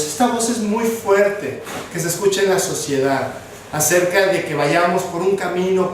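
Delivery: ordinary talk, nothing marked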